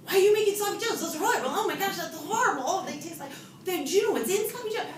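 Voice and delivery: high, loud indignant rant; wife ranting at husband about sloppy joes. Retold or imitated. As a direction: tear into him over dinner. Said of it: "go to town".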